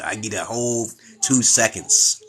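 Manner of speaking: ordinary talk, nothing marked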